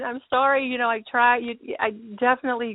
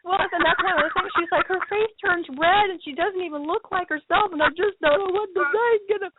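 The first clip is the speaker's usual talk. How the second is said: mock worried girl; 8 semitones higher than usual.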